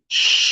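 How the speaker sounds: long voiceless shush; hushing "sh". Shown as a demonstration. Teacher demonstrating the first sound of X, sustained shush. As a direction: hush with a sustained "sh". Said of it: "el sonido del silencio".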